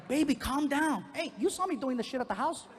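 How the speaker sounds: high-pitched imitation of a girlfriend stepping in. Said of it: "my girlfriend grabbed me".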